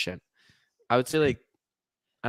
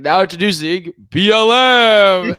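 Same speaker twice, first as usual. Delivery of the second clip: loud, drawn-out stadium-announcer call; announcer impression; 3 semitones higher, 12 dB louder, slower than usual. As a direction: announce it big, stretching the last letters. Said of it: "I was like".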